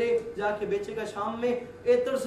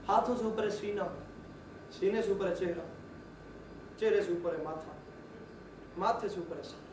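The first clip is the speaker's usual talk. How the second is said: each phrase set apart by pauses, fading softer; measured paused cadence; 3 semitones lower, 6 dB quieter than usual. Acted. Slow paused list building down.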